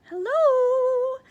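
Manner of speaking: high, loud sung-out hello; bright called greeting; calling hello. Acted. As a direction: call out as you step inside. Addie calls greeting entering.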